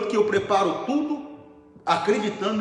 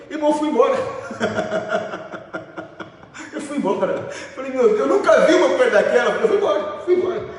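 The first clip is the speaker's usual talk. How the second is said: louder, breaking into laughter mid-story; laughing; 7 semitones higher, 5 dB louder than usual. Real, not acted. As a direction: laugh through the punchline. Pastor laughs retelling the widow. (rindo alto enquanto conta)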